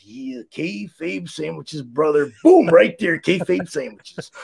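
slow, low wrestler growl; Macho Man voice. Retold or imitated.

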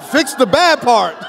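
normal talking voice

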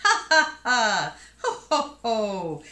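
guffaw as a storybook elephant; ho-ho and ha-ha laughter. A narrator's reading. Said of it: "He ho-hoed and ha-hawed".

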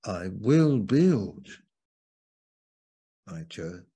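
"will build" stretched and loud with "build" swooping up, "my church" dropped; stress on BUILD. Shown as a demonstration. Preacher offering a third emphasis, stress on "build". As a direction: swell onto "build", then drop away. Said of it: "Or I could emphasize".

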